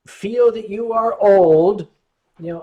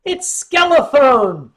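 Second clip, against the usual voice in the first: slow, loud, higher-pitched reveal; mock announcement; 4 semitones higher, 7 dB louder, slower than usual. Teasing the skull-faced dragon with a slow loud pun. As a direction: draw the name out like a grand joke. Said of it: "I said".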